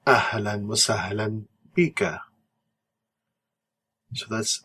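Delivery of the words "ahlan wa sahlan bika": slow, careful Arabic Ahlan wa sahlan bika; teacher modelling the reply; Arabic response. Shown as a demonstration. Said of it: "The response is".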